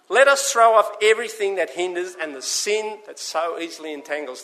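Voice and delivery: preacher reading the Bible aloud; scripture reading. Acted. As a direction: proclaim the verse to the congregation.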